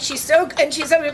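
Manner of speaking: usual speaking voice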